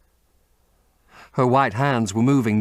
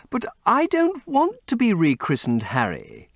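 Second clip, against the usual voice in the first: higher, light teasing lady's voice; playful Duchess; 13 semitones higher than usual. A narrator's reading. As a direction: tease him sweetly as the Duchess.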